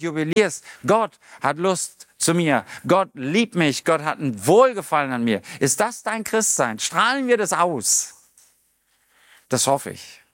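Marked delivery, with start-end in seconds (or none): none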